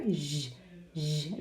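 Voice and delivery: low, soft buzzing "Zh"; isolated voiced "zh", repeated; voiced ZH sound. Shown as a demonstration. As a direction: hold "zh" alone so it can be heard. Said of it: "Can you hear?".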